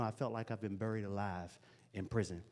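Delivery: ordinary talk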